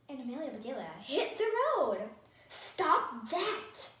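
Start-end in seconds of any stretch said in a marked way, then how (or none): 2.5-3.9 higher, louder storybook shout; sharp order read as a shout. A narrator's reading. Mister Ruggers stopping Amelia Bedelia's stick hitting, shouted. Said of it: "shouted Mister Ruggers".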